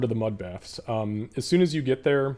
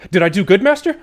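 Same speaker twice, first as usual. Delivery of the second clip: very high and loud, panting for praise; a pup desperate to please its master; eager dog, much higher; 9 semitones higher, 10 dB louder, faster than usual. Acted. Dog brings the apple back, asking for praise, very high and loud.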